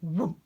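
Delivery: loud thudding whoomp; loud landing thud. Acted. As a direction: thump it down. Rocket lands.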